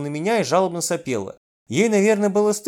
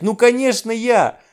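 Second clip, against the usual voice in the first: higher, louder, obvious-answer exclamation; emphatic blurting; 7 semitones higher, 5 dB louder, slower than usual. A narrator's reading.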